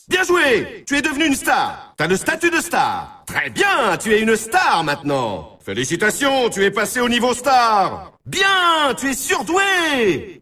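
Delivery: high-pitched, hyped-up congratulations; triumphant, ringing announcer calls; excited game announcer. Acted. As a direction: cheer the player on like an arcade sports announcer.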